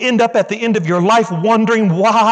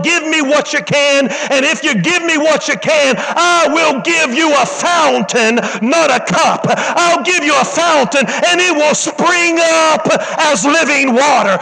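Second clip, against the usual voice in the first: slow, high preaching that swells to a shout with a laugh; full-force preaching; 5 semitones higher, slower than usual. Acted. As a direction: preach it out and let it build to a cry. Preacher quoting Jesus, rising.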